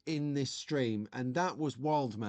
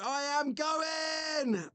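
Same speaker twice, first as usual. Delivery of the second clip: very high chipmunk voice, slow and shouted; a mock-grand chipmunk exit; chipmunk sign-off; 11 semitones higher, much slower than usual. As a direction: drag out the goodbye in a squeaky chipmunk voice. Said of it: "this chipmunk stuff".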